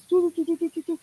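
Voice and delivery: high run of little doo-doos; light, high-pitched whirring chatter; busy brain noise. Retold or imitated. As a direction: imitate a brain ticking away. Imitates the brain constantly working.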